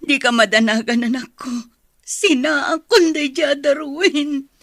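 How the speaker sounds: crying. Acted. Mother crying over husband.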